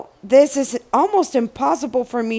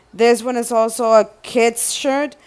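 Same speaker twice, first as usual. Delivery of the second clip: ordinary talk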